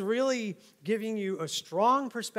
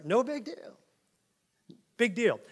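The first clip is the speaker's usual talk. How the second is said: high, sneering little voice, ending on a rise; mocking; 6 semitones higher than usual. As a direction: brush it off with a mock shrug. Mocking the false teachers' claim.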